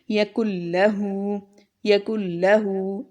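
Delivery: यकुल्लहू with noon dropped and a doubled, held ल्ल; merged noon. Shown as a demonstration. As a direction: slowly, merge into a long ल्ल with no n. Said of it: "आवाज़ों को मुदगिम कर देंगे". (यकुल्लहू में इदगाम, नून नहीं)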